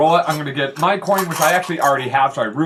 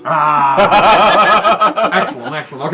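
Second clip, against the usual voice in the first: delighted laugh; 4 semitones higher, 8 dB louder, much slower than usual. Real, not acted. GM delighted by episode title.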